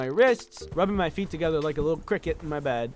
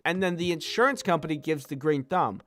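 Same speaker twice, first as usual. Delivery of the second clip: ordinary talk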